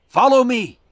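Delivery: rally them to follow; urgent command. A narrator's reading. Hawkeye urging the group down into the fog, urgent call.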